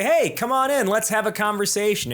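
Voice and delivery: bright, eager welcome; inviting call. Shown as a demonstration. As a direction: call them over warmly and loudly. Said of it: "you want to say".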